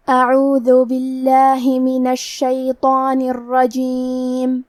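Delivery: slow, drawn-out melodic tajweed recitation; measured reverent chanting of the verses; Quran recitation. Acted.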